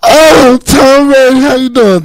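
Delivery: put-on very high, girlish fangirl squeal; screaming like a chick. Man greeting Tom Brady, screaming like a chick. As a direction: squeal it like a starstruck girl. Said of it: "like, like a chick".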